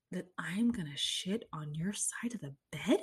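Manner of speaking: hushed, secretive imagined thought; mock whisper. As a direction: whisper it like a guilty confession.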